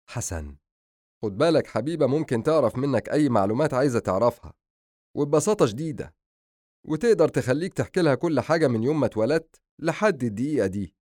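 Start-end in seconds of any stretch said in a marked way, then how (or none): 1.2-11.0 put-on raised, animated young man's voice; lively, exaggerated warning; teasing younger brother. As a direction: warn him playfully about your sister. Brother warns patient.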